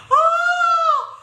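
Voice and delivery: very high loud cry of laughter; real laughing outcry; high shriek of laughter. Real, not acted. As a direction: let out a squealing laugh. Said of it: "do you know why I'm laughing".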